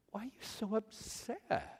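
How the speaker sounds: high but suddenly softened plea; bewildered self-questioning. Acted. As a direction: question yourself in hushed disbelief. Asks himself after the outburst.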